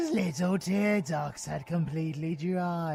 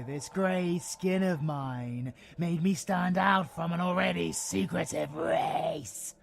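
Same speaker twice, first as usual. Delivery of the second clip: ordinary delivery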